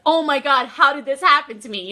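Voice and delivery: loud, delighted disbelief; happy shock. Shown as a demonstration. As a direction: burst out as if thrilled and stunned. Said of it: "happy shocked".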